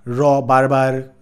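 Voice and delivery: "র" and "বারবার" with a clear tapped r, slowly; r sound demo, "বারবার" rolled lightly; Bangla r demo. Shown as a demonstration. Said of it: "যে আমরা র বলি না".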